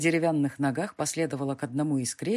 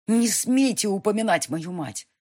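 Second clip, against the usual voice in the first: higher, louder furious retort; angry hiss; 5 semitones higher, 5 dB louder than usual. A narrator's reading. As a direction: spit it out in fury. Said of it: "зашипела я".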